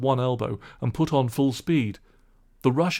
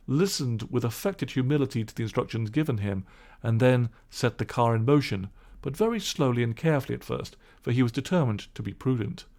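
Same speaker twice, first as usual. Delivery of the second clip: usual speaking voice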